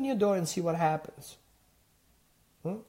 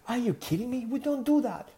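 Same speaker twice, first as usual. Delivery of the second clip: shocked host; 6 semitones higher than usual. Retold or imitated. Japanese host shocked by hugging.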